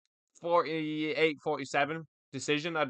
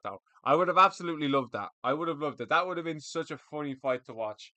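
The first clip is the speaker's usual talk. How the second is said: normal talking voice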